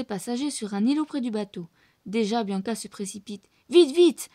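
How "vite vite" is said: urgent. A narrator's reading. Bianca rushes.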